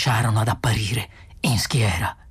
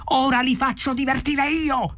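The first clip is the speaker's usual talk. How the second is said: high-pitched, raging threat; furious yell; 12 semitones higher than usual. A narrator's reading. Arthur storms out of the pub, furious yell. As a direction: cry it out in outrage, pitch way up. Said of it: "urlò".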